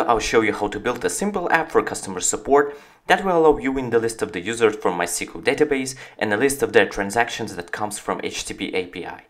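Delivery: normal talking voice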